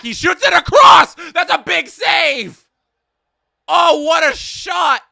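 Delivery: loud high play-by-play yell; excited shout. Real, not acted. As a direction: explode with the big save. Big save, live commentary.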